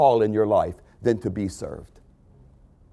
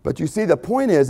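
ordinary talk, nothing marked

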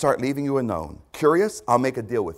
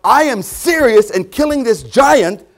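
determined vow; 8 semitones higher, 9 dB louder than usual. Acted. Voicing a determined believer.

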